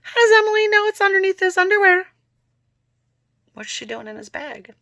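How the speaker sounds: a real jolt of nosy surprise; incredulous aside. Real, not acted. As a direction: blurt it out in shock. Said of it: "Thinking to myself right now".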